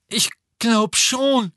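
put-on high slow boy's voice, sure of himself; Neville, relieved. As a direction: reassure Harry as Neville. Neville answers Harry.

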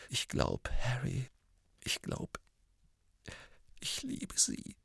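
choked, fading to a breathy whisper; words catching in the throat. Acted. Ron confessing his love to Harry, choked. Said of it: "mit erstickter Stimme".

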